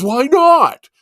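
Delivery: mock outraged squeak from the lady at the expo; mock indignant why not. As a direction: play the baffled lady protesting. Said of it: "And she goes".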